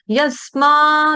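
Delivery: यस माँ very drawn out for pupils to copy; slow chanted यस माँ, each part held; repeat-after-me model. Shown as a demonstration. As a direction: lead the class with a long, sustained यस माँ. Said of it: "मेरे पीछे बोल देंगे".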